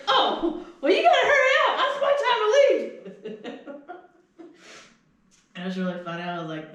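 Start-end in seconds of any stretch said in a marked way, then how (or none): 0.1-3.7 loud, high-pitched mock yelling; imitating impatient holler. Lady rushing her late relief, imitated loud holler. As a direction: holler it across the lot, hammed up.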